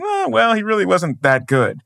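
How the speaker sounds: high drawn "ah, well," then settling; put-on grudging dismissal; dismissive mock quote. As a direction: mimic a doubter writing off a great. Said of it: "look at Bobby Orr and go".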